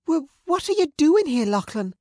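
very high, loud stammered question; frightened shock. Acted. Sister confronting the attacker she knows, shocked and frightened. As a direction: stammer in alarmed disbelief.

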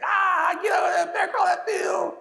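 high, loud hammed-up coach bellow at the players; angry coach yelling. Imitating an angry coach yelling at his defensive backs. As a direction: yell like a furious coach at practice. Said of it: "he's getting mad".

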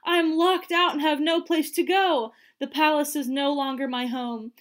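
high, loud wail of dismay, easing slightly; distressed cry. A narrator's reading. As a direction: cry out as one shut out. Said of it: "she cried".

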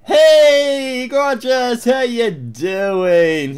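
loud, high, slow flirty call; flirty greeting. Pretend. Guard greeting a handsome traveller, loud flirty. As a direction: greet him like an old friend.

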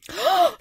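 sharp, high mock gasp; hammed-up loud gasp; shocked gasp. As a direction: gasp like a scandalised lady.

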